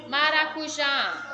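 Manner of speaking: Maracujá slowly, with a strong final já; stress on last syllable. Shown as a demonstration. Teacher showing pupils the strong syllable, Maracujá stressed slowly on já. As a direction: draw out Maracujá and lean on the já. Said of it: "a sílaba forte está na última".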